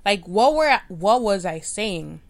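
loud, disbelieving cry at her own recording; incredulous self-quote. Retold or imitated. Replaying her own podcast take, loud disbelief. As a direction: cringe out loud at the playback. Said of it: "I be like".

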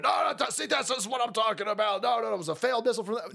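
high, rapid mock rant; hammed-up pundit talking fast; imitating a pundit ranting in a rush; mock Ben Shapiro rant. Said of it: "he starts screaming".

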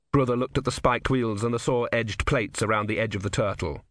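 plain reading voice

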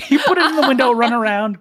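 high, fast, laughing rush; laughing frantic. Pretend. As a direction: rattle it off laughing in a frenzy. Frantic waiter mime, laughing.

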